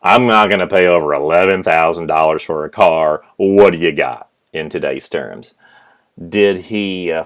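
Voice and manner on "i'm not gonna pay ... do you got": louder, gruff stubborn dad cutting in; blunt father. Retold or imitated. Father interrupting the salesperson, louder and blunt. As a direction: slap down the salesman with a hard price. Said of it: "my dad just interrupts him and says".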